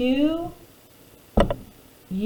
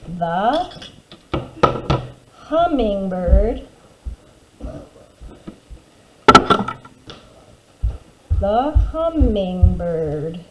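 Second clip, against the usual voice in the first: slow dictation; much slower than usual. Shown as a demonstration. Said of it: "neatly rewrite the sentence".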